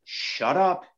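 slow, spaced-out retold order to pipe down; firm hushing. Angler silencing his buddy while working a fish, firm slow hushing. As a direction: tell a pestering buddy off, very deliberately.

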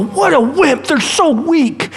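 high, sneering scoff; mock them like someone in the crowd; a high, jeering put-down. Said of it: "the crowds that go".

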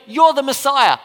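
loud, eager acclaim; excited declaration. Retold or imitated. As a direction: blurt it out like a proud disciple. Peter's declaration acted out.

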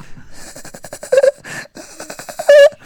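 very high haunting giggle; imitated laughter. Laughter in the back of his mind, high giggle. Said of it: "he hears".

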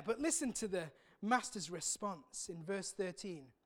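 ordinary delivery

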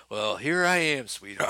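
slow, loud movie-star line; movie quote. Acted. Answering with the movie's famous line, slow and loud. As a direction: drawl it out like a film hero.

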